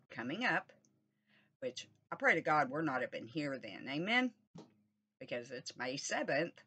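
normal talking voice

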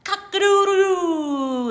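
loud high crowing rooster call. Acted. Reading to a child, the rooster crows loudly. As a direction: crow it out like a proud rooster. Rooster crow in read-aloud.

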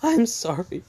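high, breaking, pleading sorry; tearful apology. Retold or imitated. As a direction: beg forgiveness on the verge of tears. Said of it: "And John's like".

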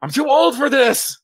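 loud, high mock-exasperated cry; exasperated shout. Real, not acted. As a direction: throw up your hands and yell it. Complaining after popping neck.

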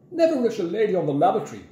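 put-on quick, high, indignant old lady; barked retort. A narrator's reading. Mrs Trifle from inside the toilet, barked retort. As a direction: bark it back through the door. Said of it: "barked Missus Trifle from inside".

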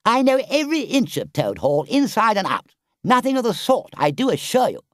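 high, loud, cocksure protest; airy Toad. A narrator's reading. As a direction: dismiss it with boastful confidence. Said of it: "said Toad rather airily".

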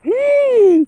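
sharp high gasp of fright; a startled little scream; frightened shriek. Real, not acted. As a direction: shriek in sudden fear. Scared by something in forest.

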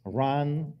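ordinary talk, nothing marked

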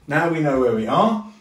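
usual speaking voice

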